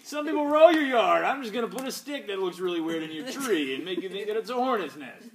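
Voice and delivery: high, loud holler to a neighbour; mock shouting. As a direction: call out across the yard in pretend confrontation. Calling out, mock address to neighbour.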